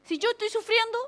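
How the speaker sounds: high, loud mock-melodramatic lament; alma sufriente. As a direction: play the self-pitying soul, protesting theatrically. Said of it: "nuestra alma qué quiere? ¿Que sufran con ella?". (queja melodramática, aguda y fuerte)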